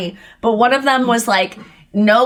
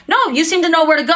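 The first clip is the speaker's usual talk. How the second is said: fast, higher snippy retort; snippy guard; 6 semitones higher, much faster than usual. Retold or imitated. Imitating the guard's fast, higher snippy comeback to her. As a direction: rattle it off quickly and snippily. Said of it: "And he was like".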